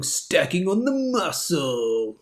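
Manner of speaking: mock strained drawl on the last word; jokey drawn-out. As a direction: stretch it out for a silly effect. Playful drawn-out joke phrase.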